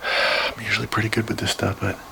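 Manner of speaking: ordinary talk, nothing marked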